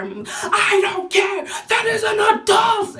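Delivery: high, loud slow mock yell; mom yelling. Retelling mom shouting her down, high and loud. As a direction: bellow it like an angry mother.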